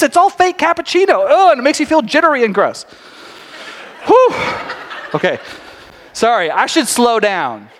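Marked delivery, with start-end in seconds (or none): 4.0-4.3 whew. Real, not acted. After rapid-fire rant.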